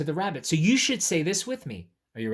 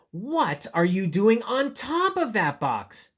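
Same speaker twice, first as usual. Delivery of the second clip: high, slow, wondering question; exaggerated, drawn-out amazement; incredulous question; 6 semitones higher, 4 dB louder than usual. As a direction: ask it in a big storybook voice. Reads the question to ask bunny.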